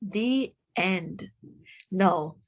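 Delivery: ordinary delivery, nothing marked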